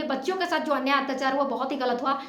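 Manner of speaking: ordinary delivery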